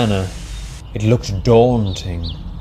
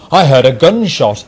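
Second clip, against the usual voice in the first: louder, higher exclamation; raised voice of an alarmed workman; exclaiming; 4 semitones higher, 6 dB louder than usual. A narrator's reading. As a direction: exclaim it urgently as a witness. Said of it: "exclaimed a workman".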